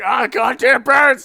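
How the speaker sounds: hammed-up furious shout; angry yell. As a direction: bellow at the birds in fury. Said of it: "He's just like".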